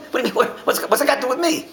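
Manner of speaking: high, laughing imitation of the driver; mock puzzlement. Teacher laughingly imitating the baffled driver. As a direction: mimic him while laughing. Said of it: "straight face".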